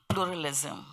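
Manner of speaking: ordinary talk, nothing marked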